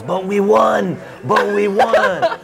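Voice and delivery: high, pleading mock shout; fleeing dad's protest. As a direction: wail it over the shoulder while running off. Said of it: "as he's running away, he goes".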